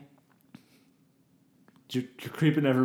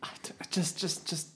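hushed whisper; 4 semitones higher, 9 dB quieter than usual. Acted. Hushing child at funeral.

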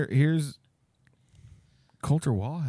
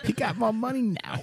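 Mike Tyson voice; 8 semitones higher than usual. Pretend. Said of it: "I sound like Mike Tyson".

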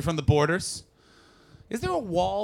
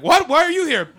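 mock German surprise; 10 semitones higher, 9 dB louder than usual. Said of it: "And they're like".

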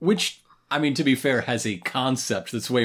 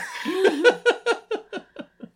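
long, high peal of laughter; laughing at own verdict. Real, not acted. Host wishing the show was better, laughing. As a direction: break into a high rolling laugh.

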